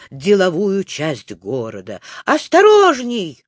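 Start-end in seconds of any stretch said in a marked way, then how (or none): 2.2-3.4 high, loud panicky cry; raised, alarmed yelp; alarmed shout. A narrator's reading. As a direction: shout a warning at a reckless driver. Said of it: "кричал Незнайка".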